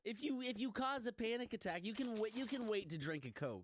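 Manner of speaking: high, insistent telling-off; worked-up, pleading reprimand; exasperated scolding. Real, not acted. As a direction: scold a friend in comic exasperation. Scolds friend over drinking Coke.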